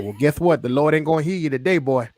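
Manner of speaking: quick, drawling wrestler's taunt; Dusty Rhodes impression. Dusty teasing a fan who prayed he wasn't there, impersonated. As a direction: throw the line back like a cocky Southern legend. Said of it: "That is a great fucking impression".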